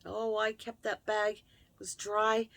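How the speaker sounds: ordinary talk, nothing marked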